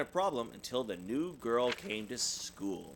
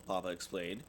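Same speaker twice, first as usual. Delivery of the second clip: plain reading voice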